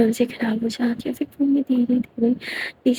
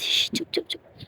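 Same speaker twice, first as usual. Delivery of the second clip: urgent whisper, no voice at all; hushed whisper; 11 dB quieter, much slower than usual. Acted. Bhumi whispering to Geeta beside the sleeping child, hushed. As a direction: hush her fast so the child stays asleep.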